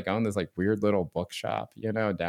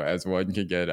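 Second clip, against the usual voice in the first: usual speaking voice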